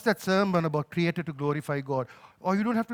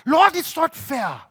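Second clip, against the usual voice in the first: high, drawn-out grievance; aggrieved lament; protesting complaint to God; 5 semitones higher, 5 dB louder, slower than usual. Retold or imitated. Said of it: "have felt in this season".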